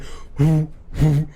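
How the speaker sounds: airy repeated "hoo hoo"; jug hooting. Acted. Cletus blowing his moonshine jug, hooting. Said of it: "with a big old moonshine jug going".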